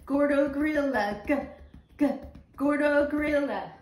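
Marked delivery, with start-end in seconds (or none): none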